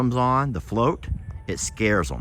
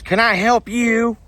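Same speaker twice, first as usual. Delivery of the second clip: high, put-on bartender asking; gruff-ish joke bartender; 12 semitones higher, 4 dB louder than usual. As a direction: voice the barman in a lifted tone.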